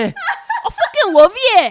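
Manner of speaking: very loud, high Scottish yelling; Scottish husband impression. Husband fighting with his wife, shouted Scottish accent. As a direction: bellow it in heavy Scottish, mock-furious. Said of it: "this is my impression of him".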